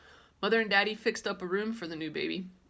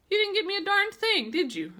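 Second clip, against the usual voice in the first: loud, much higher growly accusation; 13 semitones higher, 7 dB louder than usual. Acted. Girl accusing her mother of giving nothing, loud growl. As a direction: snarl it at mother in a put-on voice. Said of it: "I said very growly".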